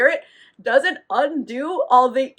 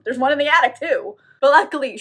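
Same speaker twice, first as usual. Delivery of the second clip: ordinary talk, nothing marked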